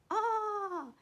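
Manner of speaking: high, loud "ah"; shocked cry. Acted. Hippo gulping fire water, shocked cry. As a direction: cry out as the mouth burns. Hippo chokes on big mouthful.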